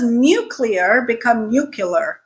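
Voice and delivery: nuclear as NEW-klee-er, then NEW-kyuh-ler; pronunciation contrast. Shown as a demonstration. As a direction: turn the CLEAR of nuclear into "killer". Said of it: "killer instead of clear".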